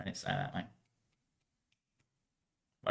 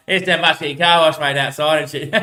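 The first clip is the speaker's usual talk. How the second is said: loud; 4 semitones higher, 5 dB louder than usual. Retold or imitated. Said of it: "I was like".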